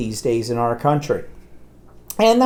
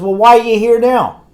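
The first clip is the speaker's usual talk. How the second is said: mock scolding doctor; 9 semitones higher, 7 dB louder than usual. Doctor scolding mother in retelling.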